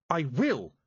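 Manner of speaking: put-on girl shouting her promise; shouted. A narrator's reading. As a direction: spit it back defiantly. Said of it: "shouted Tiffany".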